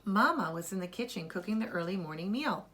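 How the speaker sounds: plain reading voice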